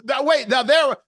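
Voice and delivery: loud, high comic denial; Sergeant Schultz. Acted. Host quoting Hogan's Heroes, loud sergeant impression. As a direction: proclaim it as a put-on sitcom catchphrase. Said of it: "that was Sergeant Schultz".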